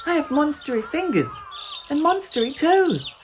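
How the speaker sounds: put-on very high, loud playful child voice; a little girl pretending to be a monster; giddy child voice playing monster; little monster game. A narrator's reading.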